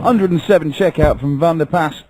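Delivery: excited. Real, not acted. Commentator excited at checkout.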